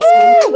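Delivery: high, loud cheering whoop; excited woo. Real, not acted. Host cheering the club streaks, loud high whoop. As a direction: whoop it up for the clubs.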